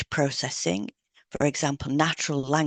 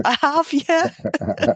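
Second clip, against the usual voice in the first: laughing through the words, voice jumping high; amused, laugh it out; 10 semitones higher, 4 dB louder, much slower than usual. Real, not acted. Laughing at the joke remark.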